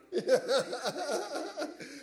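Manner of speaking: long bubbling belly laugh; real laughter. Real, not acted. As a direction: laugh heartily at your own point. Jokes about parents wanting obedience.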